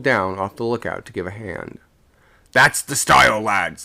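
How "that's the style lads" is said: hearty encouraging call up the rigging; a sailor's loud, encouraging call; called out encouragingly. A narrator's reading. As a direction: cheer the lads on from below. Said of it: "the second sung out, encouragingly".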